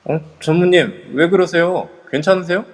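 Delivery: worried. Acted. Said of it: "성재가 걱정하는 눈으로 보자".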